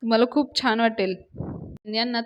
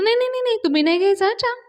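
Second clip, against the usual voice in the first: high, loud panicked no, no, no; alarmed protest; 11 semitones higher, 4 dB louder, much faster than usual. Retold or imitated. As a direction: stop her from sipping, in a rush. All stop her drinking bitter tea.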